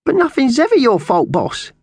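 very high, loud magpie voice; squawked. A narrator's reading. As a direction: squawk in protest, dropping on the last word. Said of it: "Slasher squawked".

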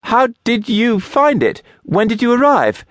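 high, flustered questions; man caught off guard, asking in dismay; startled dismay. A narrator's reading. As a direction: blurt them out, caught off guard. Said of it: "eyes went wide with horror".

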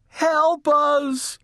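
high, loud cry for rescue; calling for help. A narrator's reading. Missus Watson stuck upstairs, calling for help. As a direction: call out high and urgent. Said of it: "Missus Watson called".

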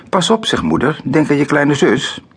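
hoge, waarschuwende moederstem; waarschuwend. A narrator's reading. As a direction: waarschuw je kind, hoog uitgesproken. Mother warns child bumping her belly. (hoge moederlijke waarschuwing)

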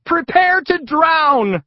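very high, slow, loud squeaky cry; dramatic warning. Acted. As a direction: announce doom like a cartoon. Dramatic warning before river plunge.

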